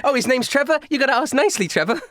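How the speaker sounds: high-pitched airy coaxing; high showgirl voice; stage magician showman. Acted. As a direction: coax him sweetly in a high voice. Magician learns name, repeats demand.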